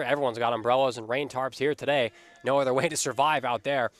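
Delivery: ordinary talk, nothing marked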